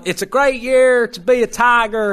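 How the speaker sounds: high, loud, slow mock Southern accent; Brian Kelly's fake Southern accent. Host mimicking the coach cheering the Tigers in a mock Southern accent. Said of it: "this fake Southern accent".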